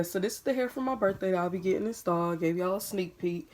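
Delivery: ordinary talk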